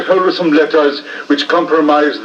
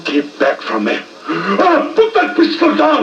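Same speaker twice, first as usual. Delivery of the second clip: fear; 4 semitones higher, slower than usual. Acted. Milverton facing a pistol.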